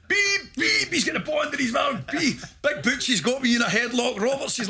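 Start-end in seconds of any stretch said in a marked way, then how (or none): 0.1-0.9 high squeaky mock referee whistle, louder; whistle beeps. Mimicking the coach's whistle at training, high beeps. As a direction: chirp it like a ref's whistle. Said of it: "running about with a whistle".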